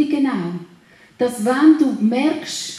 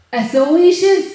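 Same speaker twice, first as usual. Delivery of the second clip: loud, certain proclamation; loud, sure so ist es; bold declaration; 6 semitones higher, 8 dB louder than usual. Shown as a demonstration. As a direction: slam it down as settled fact. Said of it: "wenn du das aussprichst und sagst".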